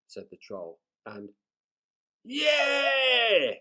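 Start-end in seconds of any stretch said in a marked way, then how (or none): 2.2-3.5 loud, high cheer; cheering shout. A narrator's reading. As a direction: pirates cheering for the cook. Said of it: "shouted the pirates".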